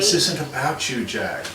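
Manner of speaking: gentle line, dropping on the name; compassionate. Shown as a demonstration. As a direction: say it with sympathy for him. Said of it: "You know, more compassion".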